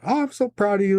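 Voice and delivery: a hint of a mom choking up; tearful mom. Mom tearing up, telling her son she is proud. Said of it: "she's tearing up".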